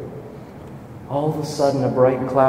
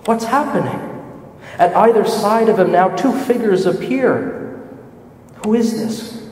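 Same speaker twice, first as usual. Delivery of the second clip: higher, rising disbelief; breathless wonder, higher pitched; awed amazement; 6 semitones higher than usual. Acted. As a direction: gasp out the wonder of what you see. Awed at the Transfiguration.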